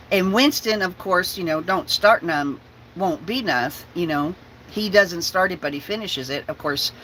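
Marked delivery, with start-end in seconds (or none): none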